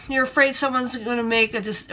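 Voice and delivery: ordinary delivery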